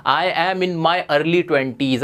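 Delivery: stretched very slowly, each word apart; slow model phrase. Shown as a demonstration. Teacher modelling a new phrase for students, very slow. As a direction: draw the phrase out for the class. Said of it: "आप उसको answer कर सकते हो".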